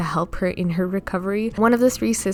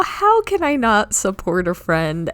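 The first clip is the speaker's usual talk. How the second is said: ordinary delivery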